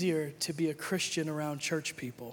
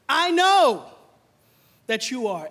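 insisting loudly; 4 semitones higher, 9 dB louder, slower than usual. Retold or imitated. Accuser insisting.

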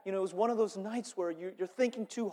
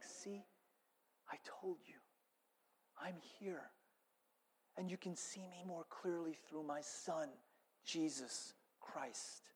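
very soft, hushed voice of God; barely above a breath, gently; softly voiced God speaking; God's whisper; 2 semitones lower, 14 dB quieter than usual. Voicing God's quiet whisper.

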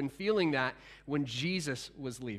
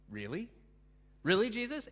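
incredulous; 4 semitones higher than usual. Real, not acted. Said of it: "pastor's own incredulous reaction".

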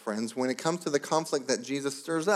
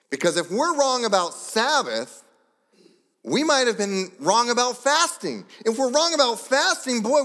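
rising higher and louder with each clause; climbing sermon intensity, pulpit full force; building preaching; 7 semitones higher, 4 dB louder than usual. Acted. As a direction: preach it up to a peak.

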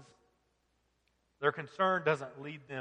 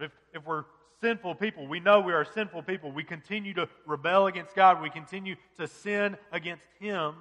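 normal talking voice

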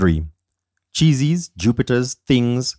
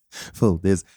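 normal talking voice

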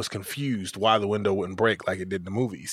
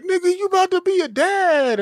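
much higher, louder hyped-up shout; excited friend; 5 dB louder than usual. Retold or imitated. Friend hyped that he's about to be a dad. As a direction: burst out thrilled for him. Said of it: "he was all excited and whatnot".

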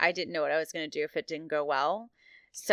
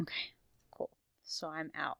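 whisper; 8 dB quieter than usual. Real, not acted. Sneaking away quietly.

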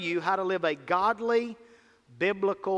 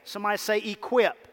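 ordinary delivery

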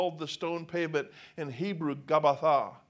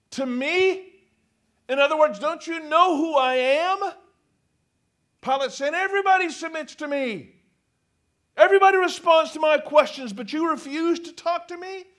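higher, louder, outraged ruler; indignant; 8 semitones higher, 7 dB louder than usual. Acted. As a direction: play the affronted governor. Preacher voicing indignant Pilate.

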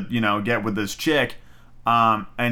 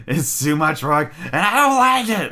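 Igor voice; 4 semitones higher, 3 dB louder than usual. Retold or imitated. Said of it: "fucking Igor".

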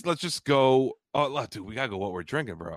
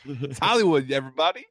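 hype announcer; 4 semitones higher, 4 dB louder than usual. Pretend. Hypes the co-host's intro.

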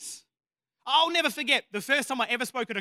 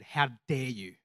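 low, deadpan rebuke; stern, deadpan challenge; 7 semitones lower, slower than usual. Retold or imitated. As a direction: deliver a retold rebuke without a trace of laughter.

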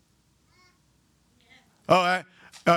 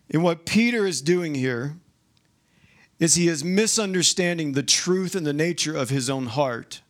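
usual speaking voice

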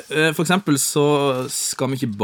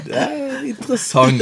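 high giggle through the words; laughing; 12 semitones higher than usual. Real, not acted. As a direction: laugh while saying it. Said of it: "laughing at the answer".

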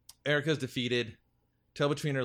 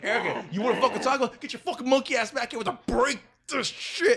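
loud, fast, high snarling gang taunt; punk gang threat; 11 semitones higher, 7 dB louder than usual. Retold or imitated. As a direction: yell it like a punk gang calling her out. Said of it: "And they're like".

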